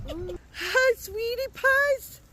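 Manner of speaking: giggly high coo; cooing pet greeting. Real, not acted. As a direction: greet your pets up high, stretching the words. Greeting pets amid laughter.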